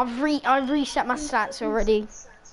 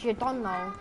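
usual speaking voice

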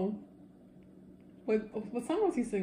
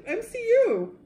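high, loud excited squeal; 13 semitones higher, 6 dB louder than usual. Real, not acted. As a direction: squeal it with glee. Excited about Marvel.

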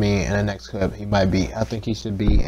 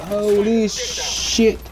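high, slow, stretched exclamation; amazed outcry; 11 semitones higher, much slower than usual. Real, not acted. Gamer stunned by the action, high drawn-out cry. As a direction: draw it out high in disbelief.

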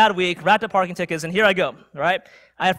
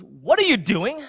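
drawn-out scolding demand, imitated; berating; 4 semitones higher, 3 dB louder than usual. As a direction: mimic an outraged driver scolding someone. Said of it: "begins to berate me".

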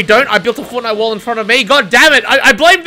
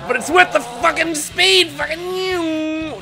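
mock taunting voice; 6 semitones higher, slower than usual. Gamer taunts victim in put-on voice.

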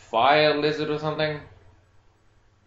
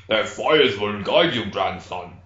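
put-on lower, slow solemn old man; old grandfather voice; 6 semitones lower, slower than usual. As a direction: intone it like a wise elder. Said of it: "my superstitious grandfather".

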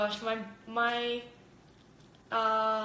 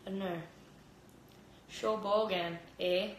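lower, lightly mocked bogan Australian; bogan Australian accent; 3 semitones lower, 6 dB quieter than usual. Mocking how Australians sound abroad, bogan Australian accent. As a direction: send up your own bogan Australian accent. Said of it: "our accents are so bogan".

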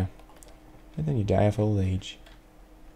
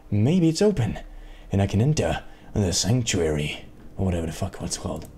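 ordinary talk, nothing marked

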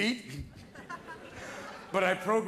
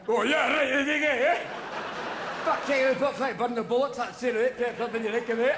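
put-on thick, garbled Scottish accent, pitched up; mock-Scottish slurred gibberish; drunken Scotsman; 2 semitones higher than usual. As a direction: rattle off incomprehensible drunk Scottish. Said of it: "go drinking with a Scotsman".

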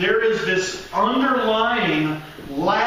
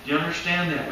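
ordinary talk

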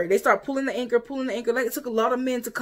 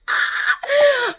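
a sudden high laugh that cracks into a cry; high laughing burst; 4 dB louder than usual. Real, not acted. Laugh breaks out mid-story.

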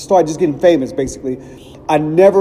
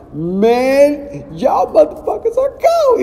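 much higher, hyped-up praise; 11 semitones higher, much slower than usual. Retold or imitated. Comedian praising the group after their set, high excited voice. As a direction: gush like a stunned fan backstage. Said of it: "[name] came up to us".

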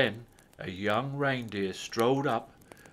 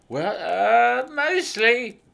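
high, loud hesitant elf voice; little old elf; 10 semitones higher, 8 dB louder, slower than usual. A narrator's reading. Elf hedging his answer to Santa, high and hesitant. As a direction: squeak it out nervously as the elf.

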